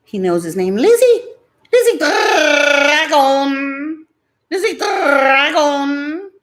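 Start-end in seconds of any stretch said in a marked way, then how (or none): 0.8-1.2 high excited call. Real, not acted. Calling pet by name. 1.7-6.3 high, slow sing-song call. Real, not acted. Calling pet by name.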